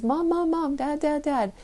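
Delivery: put-on high, insistent child calling mom and dad; child calling parent. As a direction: call out like an eager kid tugging for attention.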